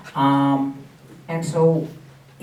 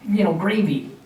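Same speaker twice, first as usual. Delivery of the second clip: demanding tourist; 6 semitones higher, 4 dB louder, slower than usual. Retold or imitated. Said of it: "and you go".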